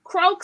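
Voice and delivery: a high croak; playful mock croak; toad croak.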